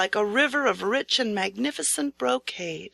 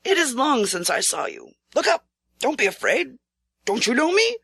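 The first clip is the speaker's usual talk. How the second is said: lightly voiced regent coaxing a frightened knight; regent's voice; 3 semitones higher than usual. A narrator's reading. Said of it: "Then a voice said".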